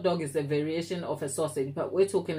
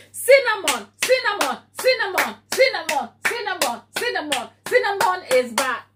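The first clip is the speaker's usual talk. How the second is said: Cinnamon chanted much higher and louder, over and over; excited calling; 11 semitones higher, 6 dB louder than usual. Real, not acted. Host greeting returning viewer Cinnamon, excited chanting call. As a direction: sing out the name like cheering a friend back.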